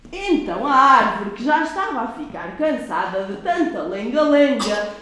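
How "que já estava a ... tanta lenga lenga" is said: ordinary delivery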